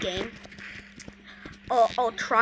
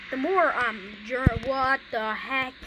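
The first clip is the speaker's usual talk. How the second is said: ordinary talk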